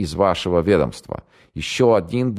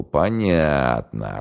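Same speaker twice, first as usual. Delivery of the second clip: very slow casual drawl; небрежно протянул; much slower than usual. Acted. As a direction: stretch it out lazily. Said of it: "небрежно протянул". (небрежно и протяжно)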